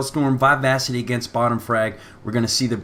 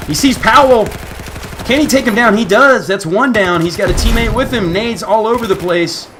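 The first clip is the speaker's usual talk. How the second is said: loud high rapid commentary; excited play-by-play; 8 semitones higher, 6 dB louder than usual. Real, not acted. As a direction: call the fight at full hype. Live play-by-play firefight.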